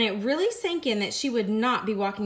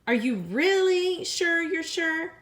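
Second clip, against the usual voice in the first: higher still, slow and drawn out; hopeful pleading girl; 7 semitones higher, 3 dB louder than usual. Acted. Lily begging Ginger one last time to quit, pleading. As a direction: lean harder on the hope, stretch it.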